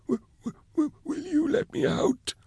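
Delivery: begging timidly to be freed; stammering plea. Acted. Prisoner begging rescuers to let him out, soft high stammer.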